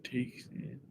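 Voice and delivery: unvoiced whisper; a hushed guess to yourself. Real, not acted. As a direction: breathe the name out in a whisper.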